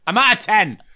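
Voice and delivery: high, loud put-on outburst; hammed-up fury at full tilt; maxed-out mock rage. Summing up Hannah's perpetual state, high loud mock rage. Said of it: "at a perpetual state of".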